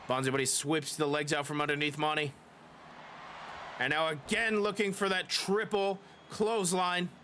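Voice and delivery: lively play-by-play announcer voice; wrestling commentator. Acted. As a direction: call the match like a wrestling commentator.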